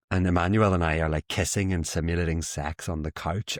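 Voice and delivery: ordinary delivery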